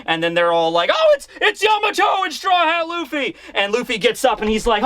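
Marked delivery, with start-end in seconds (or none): 0.9-3.3 high, shouted mock alarm; startled alarm cry. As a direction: call out the intruders, pitched up. Enemies spotting intruders, retold.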